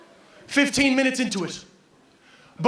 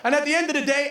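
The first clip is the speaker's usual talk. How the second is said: normal talking voice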